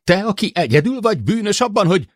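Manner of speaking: fast, loud, high-pitched tirade; heated outburst, cut off; angry accusation. A narrator's reading.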